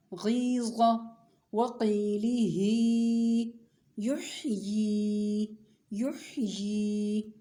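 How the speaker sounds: teacher reciting for pupils to copy, vowels drawn out; drawn-out recitation. Shown as a demonstration. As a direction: stretch each long vowel a full alif. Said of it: "खड़ी ज़ेर की मश्क".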